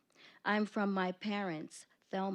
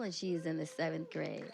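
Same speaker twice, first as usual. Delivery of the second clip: ordinary talk, nothing marked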